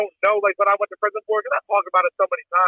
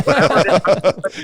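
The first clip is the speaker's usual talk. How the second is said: laughing through the words; chuckling while he talks; 2 semitones lower, 3 dB louder, much slower than usual. Real, not acted. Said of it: "You're laughing, dude".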